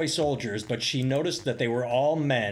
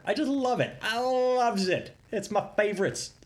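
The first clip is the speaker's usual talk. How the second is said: mock gleeful; 8 semitones higher than usual. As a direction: relish it in a silly high voice. Mock gleeful hypocrisy relish.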